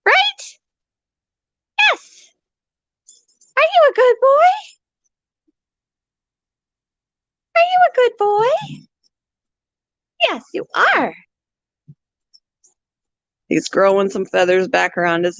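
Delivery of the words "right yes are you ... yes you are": very high cooing pet talk. Real, not acted. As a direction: coo at the parrot way up high.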